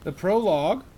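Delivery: ordinary delivery, nothing marked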